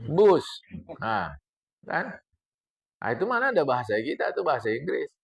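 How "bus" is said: Bus said as English bus, loud and clear; the preacher stressing that Bus is right, loud; correct pronunciation. Shown as a demonstration. Correcting the congregation, loud English Bus. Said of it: "Yang betul bus".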